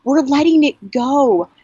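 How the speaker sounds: high, emotional cry to dad; retold scream. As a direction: call it out high, as a release. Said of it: "I just started screaming to him".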